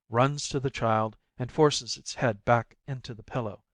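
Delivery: usual speaking voice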